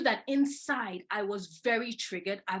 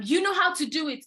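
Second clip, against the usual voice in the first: retold shout; 6 semitones higher, 5 dB louder than usual. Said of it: "Shouting and saying".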